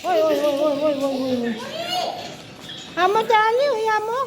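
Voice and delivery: calling out. Real, not acted. Calling daughter to get ready.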